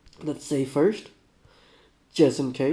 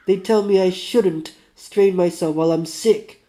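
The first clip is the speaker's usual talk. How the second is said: put-on complaining Russian accent, higher and louder; 4 semitones higher, 7 dB louder than usual. Dying man complaining he is fussed over, aggrieved Russian accent. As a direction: air a grievance in a Russian accent. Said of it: "do my best, uh, Russian".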